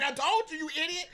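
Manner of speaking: high, loud mock scold. Friend gloating at his partner, high mock scold. S0 voices John mocking.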